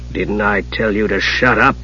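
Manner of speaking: low, soft rebuke; quietly irritated mage. A narrator's reading. Raistlin reminding the kender to be silent, irritated. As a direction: scold without raising your voice.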